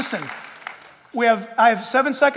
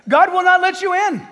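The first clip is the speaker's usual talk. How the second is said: preaching at full force; 8 semitones higher, 7 dB louder than usual. Real, not acted. Preaching climax.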